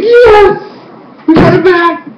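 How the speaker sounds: very high elated squeal; so happy. Retold or imitated. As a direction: re-enact a fan's victory cheer. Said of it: "I was so happy".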